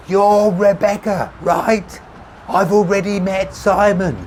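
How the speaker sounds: high shout, repeated for the hard of hearing. A narrator's reading. Tommy repeating himself over traffic, insistent shouting. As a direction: push it out loud and drawn out. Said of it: "he repeated with increasing volume".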